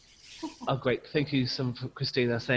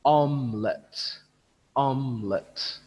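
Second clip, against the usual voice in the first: OM-elette, first syllable big and slow; stress on first syllable; slower than usual. Shown as a demonstration. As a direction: stress the start of omelette, slow. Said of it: "the sound is big".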